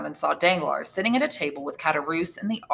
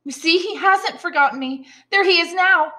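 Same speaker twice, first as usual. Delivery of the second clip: higher, rising delight; excited; 12 semitones higher, 5 dB louder than usual. Acted. Mercedes telling Fernand her lover is back, excited. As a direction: bubble over with happiness.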